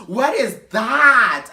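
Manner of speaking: high, loud, drawn-out disbelief; mock shock, shouted; appalled. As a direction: stretch it into a horrified holler. Cleaner appalled at the toilet.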